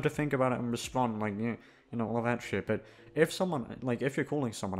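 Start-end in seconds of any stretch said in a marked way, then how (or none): none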